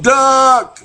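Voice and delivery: sharp high yell of warning. Retold or imitated. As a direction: shout it to make them duck. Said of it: "I yelled".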